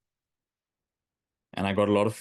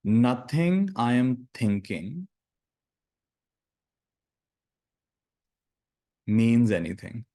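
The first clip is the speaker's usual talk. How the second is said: slow, deliberate meditation phrases with long silences; guided meditation; slower than usual. Acted. Teacher giving students lines to repeat in their minds, slow meditative delivery. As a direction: lead them slowly through each phrase, leaving space to repeat it.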